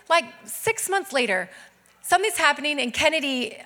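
usual speaking voice

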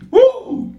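very high preacher's "woo"; spirited high holler; excited whoop. Real, not acted. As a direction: let out a sudden high whoop. Preacher's own exclamation.

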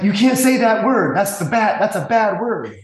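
put-on loud indignant little kid; loud scandalized child; scolding kid. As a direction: tell her off like a shocked five-year-old. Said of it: "He's like".

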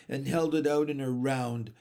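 ordinary delivery

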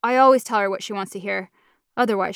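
ordinary delivery, nothing marked

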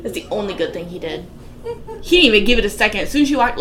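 ordinary talk

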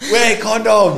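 high, loud mock-mother shout; hammed-up shrieked telling-off; scolding mum. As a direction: yell the advice as an exasperated mum. Acting out mum's scolding.

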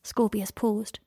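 ordinary delivery, nothing marked

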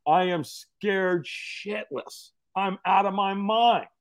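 very slow, drawn-out emphasis; dramatic dread. Retold or imitated. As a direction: stretch out each word for storytelling dread. Dramatising terror at the coals.

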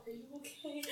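soft concern. Acted. Character checks on the frightened child, very softly. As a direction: murmur it to a scared child.

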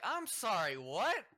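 high, loud incredulous question; baffled disbelief. Retold or imitated. Storyteller to suddenly friendly worker, loudly baffled. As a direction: blurt it out in mock astonishment. Teller acts out his baffled reply.

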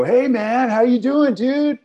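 high, loud bro voice; a high, loud dude voice calling out; loud AA buddy. Retold or imitated. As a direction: burst in on the date like an oblivious pal. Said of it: "somebody's gonna come up to you and go".

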